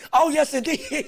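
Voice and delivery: "Oh, yes, indeed" breaking into a laugh; amused repeat dissolving into laughter. Real, not acted. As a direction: let the laugh take over the words.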